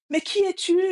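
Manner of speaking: high, loud questioning call. Retold or imitated. Child challenges the unknown voice, calling out. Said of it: "il demande à la voix".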